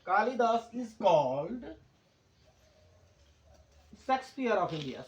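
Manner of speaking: slow, word by word; slow dictation. Shown as a demonstration. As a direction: read the example slowly for writing. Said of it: "Kalidas एक noun है". (धीरे-धीरे लिखवाते हुए)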